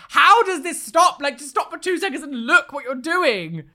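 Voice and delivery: high, exasperated retold plea; hammed-up exasperated protest; mock exasperation. Acted exasperation at teenage mess.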